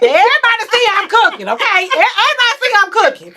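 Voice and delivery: fast, high, loud indignant protest; high loud mock outrage. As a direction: defend yourself, offended. Protesting she does cook.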